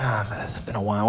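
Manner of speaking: sheepish whispered confession; hushed and embarrassed. Retold or imitated. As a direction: whisper it, ashamed of the late return. Embarrassed customer returning late movie.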